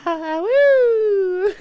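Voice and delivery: loud, high whoop of joy; excited cheer. Real, not acted. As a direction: let out a big happy cheer. Cheering on stream.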